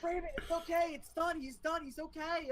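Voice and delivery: high-pitched imitation of a friend; acted-out attempt to talk someone down; pleading friend. Acts out friend calming the teller.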